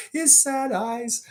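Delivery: high, slow teasing echo of his sad eyes; laugh, then drag out his sad eyes high and teasing; mocking laugh. Real, not acted. Host teasing worry about Cade's sad eyes, high mocking laugh.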